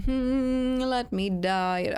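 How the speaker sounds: very slow, high, hammed-up; dramatic drawn-out plea. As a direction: stretch it out like a dying hero. Dying in game, drawn out.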